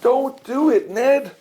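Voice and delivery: high pleading shout, pitched well up; woozy balls crying out in alarm. A narrator's reading. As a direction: call out to stop him, high and urgent.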